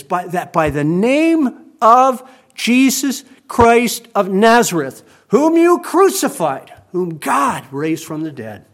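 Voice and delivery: loud, slow, ringing declaration; the apostle confronting the rulers, booming out the name; thundering proclamation. Retold or imitated. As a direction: proclaim it to the whole council, full force. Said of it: "And then he says this".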